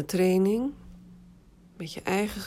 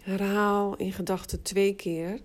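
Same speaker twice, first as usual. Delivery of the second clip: guided meditation. Acted. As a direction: guide them into rest, unhurried. Leads autogenic relaxation exercise. (rustige meditatiestem)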